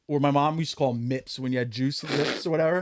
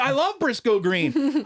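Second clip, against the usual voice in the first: excited mom voice; 11 semitones higher, slower than usual. Retold or imitated. Retold mom's excited phone reply.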